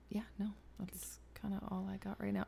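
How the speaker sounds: soft unvoiced whisper. Real, not acted. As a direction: breathe it out, barely voiced. Spent, whispering.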